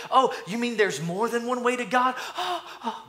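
high, gullible amazement with gasps; mock wonder. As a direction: gasp in high-pitched mock astonishment. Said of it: "some people, they, they think to be meek".

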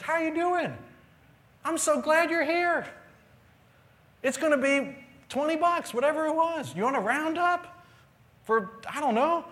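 very high, loud drive-thru cheer; exuberant greeting. Retold or imitated. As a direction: gush at the customer in a high, excited voice. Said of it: "Zach does to me what I do to other people".

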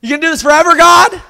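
loud, high sarcastic challenge to God; sarcastic outcry. Retold or imitated. Preacher voicing David challenging God, sarcastic shout. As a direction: fling the question upward in a shout. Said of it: "He almost gets a little sarcastic".

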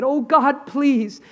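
high, pleading cry to God; desperate high prayer. Retold or imitated. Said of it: "knelt down and said".

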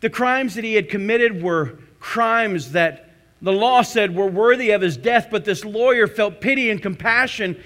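fervent sermon delivery; preaching at full force. Acted. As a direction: drive the story home from the pulpit. Preacher building the story.

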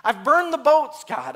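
desperate surrender. Acted. Believer with no options left to God, desperate surrender. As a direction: cry out to God, slowing on the admission. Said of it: "it's not until this place that we say".

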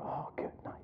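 breathless hunter's whisper. Retold or imitated. Hunter in tree stand whispering to himself as deer snorts. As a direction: hush it like a deer is right behind you.

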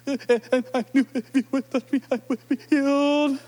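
mock sob through the words; a touch of crying; choked-up woman. Healed woman telling Jesus, mock tearful. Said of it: "seriously emotional at a moment".

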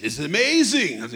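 usual speaking voice

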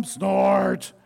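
a mock machine snort; snort noise. The snort answering the baby bird, a mock snort. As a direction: snort it like a digger.

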